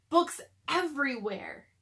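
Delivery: ordinary delivery, nothing marked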